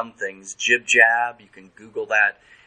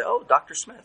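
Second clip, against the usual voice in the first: patient's reply; 11 semitones higher than usual. Retold or imitated. Voicing the patient's answer.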